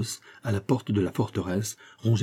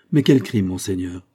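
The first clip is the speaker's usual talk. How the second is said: said aloud; 4 dB louder than usual. A narrator's reading. Governor answering the minister aloud, an alarmed question. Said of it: "ajouta tout haut".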